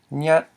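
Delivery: nyat short, loud and lifted; brief mid-tone nyat. Shown as a demonstration. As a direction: say nyat as one brief stressed syllable. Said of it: "with a mid tone".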